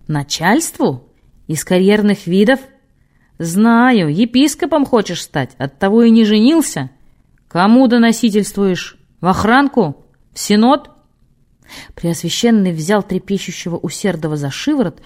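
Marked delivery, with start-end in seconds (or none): 0.1-10.9 louder, hammering accusing questions; angry interrogation. Acted. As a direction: fire the questions angrily.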